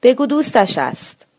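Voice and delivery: near shout. Acted. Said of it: "تقریباً به فریاد گفتم".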